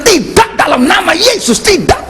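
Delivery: high, mock-holy refusal; pious refusal. Preacher mimicking a pious refusal he never gave. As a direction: play the saintly refusal he didn't make. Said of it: "Saya nggak munafik".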